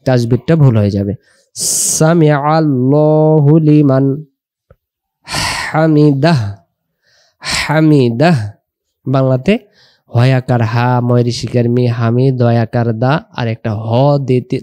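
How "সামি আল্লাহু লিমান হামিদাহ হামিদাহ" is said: slow recitation ending হামিদাহ with an audible h; clear final h. Shown as a demonstration. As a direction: let the h in হামিদাহ come out. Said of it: "হায়ের উচ্চারণটা ভেসে উঠাইতে হবে".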